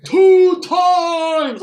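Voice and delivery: high, loud victory scream; screaming with joy; ecstatic shout. Retold or imitated. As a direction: yell it out in disbelief. Cheering the second win.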